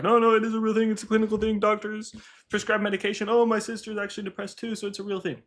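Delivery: high, whiny mocking of friends. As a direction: mimic them in a squeaky protesting tone. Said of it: "They're like".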